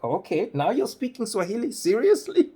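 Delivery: rising high to a loud, laughing "Seriously?"; mock incredulity. Lady catching her speaking Swahili, mock incredulous.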